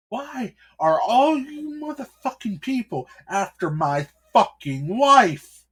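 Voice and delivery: husband roaring at the cardinal's men about his wife; furious shout. Acted. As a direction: scream it at them, slowing on the last words. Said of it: "said with a very angry tone".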